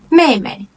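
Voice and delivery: 妹妹 starting high and falling; tone demonstration. Shown as a demonstration. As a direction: drop through 妹妹, second part unstressed. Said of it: "It's the first tone".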